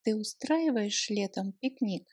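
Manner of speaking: ordinary talk, nothing marked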